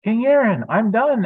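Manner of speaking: high, loud eager call; excited shout. Acted. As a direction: call out proudly to the king. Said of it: "Adam shouted".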